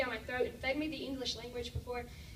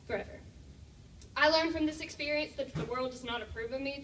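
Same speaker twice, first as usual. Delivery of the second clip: ordinary talk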